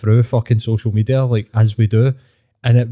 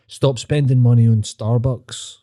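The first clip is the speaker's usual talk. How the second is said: mock guru advice.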